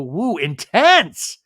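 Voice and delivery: loud, high, drawn-out exclamation; whooping reaction. Real, not acted. Host reacting to the tearful make-up, whooping emphasis. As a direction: stretch it out with a whoop. Narrator reacting to emotional scene.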